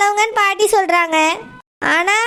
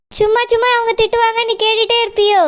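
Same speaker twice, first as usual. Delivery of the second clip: ordinary talk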